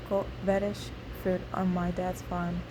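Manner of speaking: ordinary delivery, nothing marked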